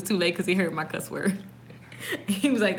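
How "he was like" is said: ordinary talk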